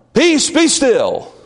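high, loud, slow command; commanding calm. Acted. Jesus ordering the storm to be still, commanding.

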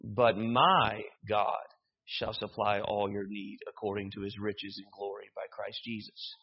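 my stretched and punched, rest read low and even; stress on my. Shown as a demonstration. Preacher rereading the verse another way, stress on my. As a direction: lean long and hard on my. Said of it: "Or we could read it".

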